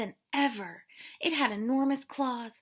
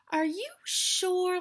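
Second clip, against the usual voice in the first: laughing; 9 semitones higher, 5 dB louder, slower than usual. Acted. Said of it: "laughed Hedgehog".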